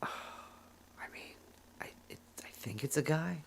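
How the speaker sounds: hushed unvoiced whisper. Real, not acted. Whispering an aside to his co-host. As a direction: let it out on a breath, barely voiced.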